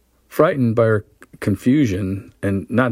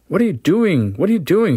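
cried in horror; 10 semitones higher than usual. Acted. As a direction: recoil in horror as you push him off. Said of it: "she cried in horror".

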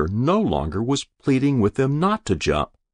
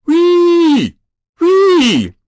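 high, loud whooping scream; screaming like an engine; 11 dB louder than usual. Acted. Boy on the roof screaming down at the crowd, high whooping shriek. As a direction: shriek it out like a siren. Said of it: "He began screaming like some kind of engine".

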